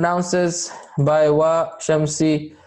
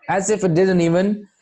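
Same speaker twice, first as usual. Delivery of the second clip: normal talking voice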